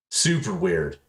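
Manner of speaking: ordinary talk, nothing marked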